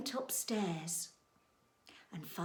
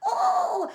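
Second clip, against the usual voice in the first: very high, loud oh; high-pitched acted yelp of fright; startled shriek; 11 dB louder than usual. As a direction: cry out as if jolted awake. Goldilocks wakes with a start.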